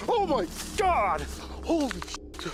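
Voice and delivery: very high, loud, drawn-out shock; terrified scream. Real, not acted. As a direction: cry out as if suddenly grabbed. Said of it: "scared me".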